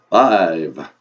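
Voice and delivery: loud vampire count, falling low; big Transylvanian accent with a long fall. Acted. Count starting the count over, booming vampire accent. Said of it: "your vampire accent".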